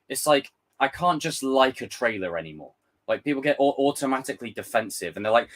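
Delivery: ordinary talk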